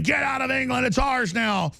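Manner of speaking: high mock shout; imitating street screaming; retold screaming. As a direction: yell it like a hostile crowd. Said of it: "people screaming".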